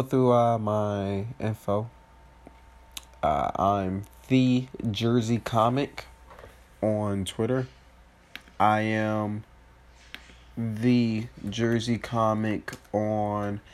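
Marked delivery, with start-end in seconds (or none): 3.6-13.6 slow careful dictation; talking slow. Real, not acted. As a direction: spell it out slowly. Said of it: "I'm talking slow".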